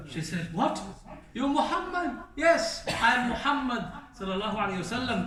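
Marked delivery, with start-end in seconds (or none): 0.5-2.2 high, astonished double take. Retold or imitated. Woman learns who helped her. 2.3-5.2 joyful reply. Retold or imitated. Prophet answers the woman.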